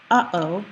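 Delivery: "uh-oh" with a tiny stop of air between syllables; glottal stop. Shown as a demonstration. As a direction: cut the airflow briefly inside "uh-oh". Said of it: "you can hear a little stop there".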